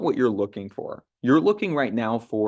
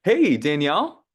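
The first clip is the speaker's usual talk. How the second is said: bright, friendly hello, louder and higher; friendly greeting; 5 semitones higher, 5 dB louder than usual. Shown as a demonstration. Trainer demos a warm call opener. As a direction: greet her like an old friend. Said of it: "recommend opening up a call is, is jumping on and saying".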